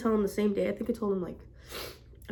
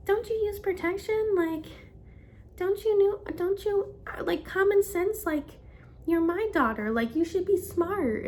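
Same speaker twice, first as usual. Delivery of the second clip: high-pitched, disbelieving scolding; shocked mom; 9 semitones higher than usual. Retold or imitated. Mom scolds her pregnant daughter in high-pitched shock. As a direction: imitate a mother reeling in shock, pitch way up. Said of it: "in shock".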